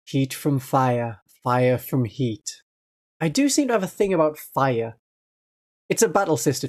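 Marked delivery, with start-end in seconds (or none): 0.1-2.6 mellowed voice. Shown as a demonstration. Streamer warming up her voice, slow and mellow. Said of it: "the thing you've got to say to mellow out your voice".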